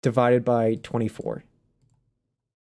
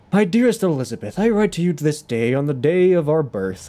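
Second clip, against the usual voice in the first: put-on formal old-time British letter-writer; ye olde British accent. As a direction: recite a letter in antique British. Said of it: "like, ye olde British".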